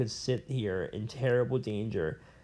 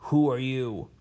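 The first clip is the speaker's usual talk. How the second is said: man's voice from the dark. Acted. Man's voice in the darkness.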